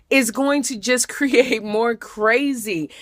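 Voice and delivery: ordinary delivery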